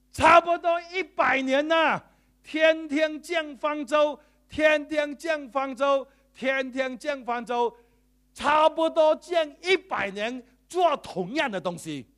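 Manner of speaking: slow, loud, high preaching. Acted. Preacher urging the church, loud and slow. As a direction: drive the point home to the congregation.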